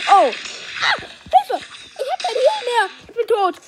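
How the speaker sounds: high panicked cry for help; panicking gamer. Real, not acted. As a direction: panic as health runs out. Health gone, about to die.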